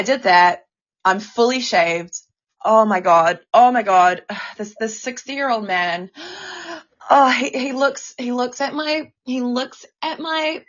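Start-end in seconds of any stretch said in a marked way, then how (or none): 6.2-6.8 sudden sharp intake of breath; sharp gasp. Real, not acted. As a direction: gasp in shock. Recalling a disgusting client.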